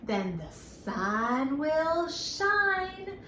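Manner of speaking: very slow, sing-song rhyme with a lift; slow rhyme recital. Shown as a demonstration. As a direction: draw each line out for the children. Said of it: "this next little rhyme".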